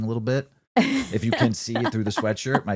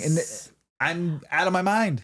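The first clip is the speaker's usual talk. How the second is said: usual speaking voice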